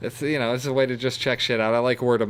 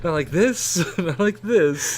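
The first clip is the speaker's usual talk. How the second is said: laughing impression; 5 semitones higher, slower than usual. Mimicking the door bit, laughing.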